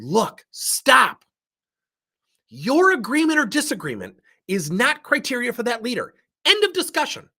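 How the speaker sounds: high, loud, firm telling-off; forceful. Retold or imitated. Manager cutting off an arguing employee, forceful. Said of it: "I did that very forcefully with her".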